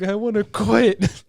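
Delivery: slow, loud, high whiny wail; mock crying whine. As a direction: whine it like a sobbing quitter. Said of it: "Fucking crying".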